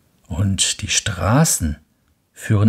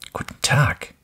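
whispered greeting from hiding; fox whispering; 5 semitones higher than usual. Acted. Hidden fox greets the prince, whispered. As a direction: breathe it from the shadows. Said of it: "sagte der Fuchs".

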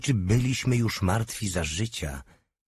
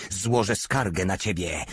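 plain reading voice

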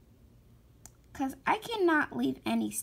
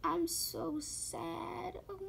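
slow, soft, teary voice; about to cry; 3 semitones higher, 5 dB quieter, slower than usual. Real, not acted. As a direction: sad she has to scroll back, near tears. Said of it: "I'm literally about to cry".

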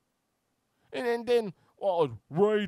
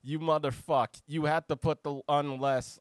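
ordinary talk

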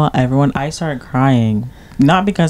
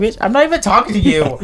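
higher, snippy comeback; sassy retort; 7 semitones higher than usual. Retold or imitated. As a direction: fire it back with attitude. Retold retort to annoying coworker.

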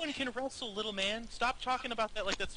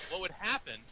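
usual speaking voice

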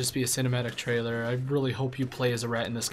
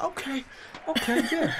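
high-pitched mock crowd voice; 14 semitones higher than usual. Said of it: "I heard someone in the crowd like".

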